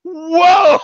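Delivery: loud, high shocked exclamation; startled whoa. Retold or imitated. Attendant hears he just got divorced, loud startled whoa. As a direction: jump back at unexpected news. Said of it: "And she literally went".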